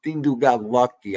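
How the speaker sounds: ordinary delivery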